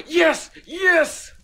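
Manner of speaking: high, loud yelps of triumph after a gasp; gasp, then cheer loudly; real burst of gamer joy; excited cheering. Real, not acted. Celebrates a find in game.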